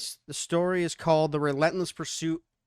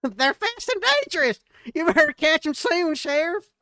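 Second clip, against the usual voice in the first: much higher, louder laughing put-on voice; townsperson voice; 13 semitones higher, 6 dB louder than usual. Townsperson warning the sheriff, in a high laughing voice. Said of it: "The townsperson one".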